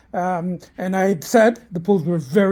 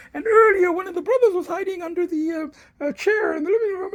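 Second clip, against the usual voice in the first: put-on cat baby talk; 13 semitones higher than usual. Showing the cats, high cooing voice.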